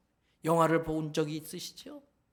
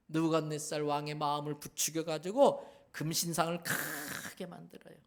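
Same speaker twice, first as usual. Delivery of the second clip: usual speaking voice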